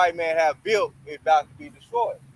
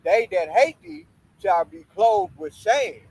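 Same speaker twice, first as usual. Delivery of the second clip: slow, weighty preaching read; scripture reading; 3 semitones higher, 4 dB louder, slower than usual. Acted. Preacher reading Babylon's doom, slow and heavy. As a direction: draw out the verse like a pulpit reading.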